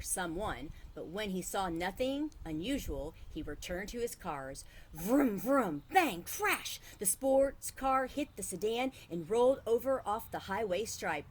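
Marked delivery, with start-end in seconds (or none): 4.9-6.8 loud vroom then bang crash; crash noises. A narrator's reading. Boy crashing toy cars, loud vroom and crash. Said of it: "making noises with his mouth".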